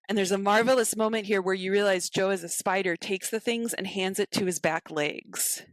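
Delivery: ordinary talk, nothing marked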